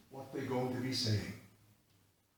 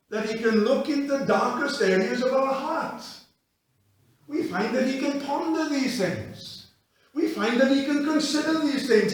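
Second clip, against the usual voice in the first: loud, higher preaching climax; full-force preaching; 8 semitones higher, 11 dB louder than usual. Acted. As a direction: lift them to the peak.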